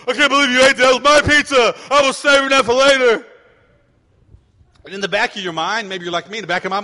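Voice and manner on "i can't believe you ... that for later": put-on high, loud child's complaint; whiny indignant kid. Kid complaining to dad about the pizza he ate, loud high whine.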